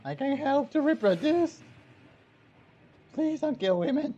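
high loud begging; mock pleading. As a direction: plead dramatically for mercy. Pleading with game monsters.

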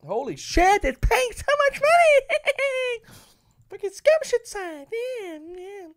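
high squealing mock excitement; sarcastic shriek. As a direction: shriek in fake joy. Ironic shriek at small win.

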